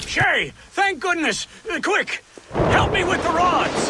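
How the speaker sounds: high, loud and urgent; frantic plea for help with the rods; urgent call. Acted. Franklin urgently calls for help.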